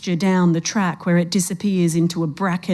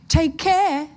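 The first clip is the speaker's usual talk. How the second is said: high, sing-song call across the distance; 10 semitones higher than usual. A narrator's reading. Old woman calling to the lost man, high sing-song call. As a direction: call it back sweetly over your shoulder. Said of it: "she calls".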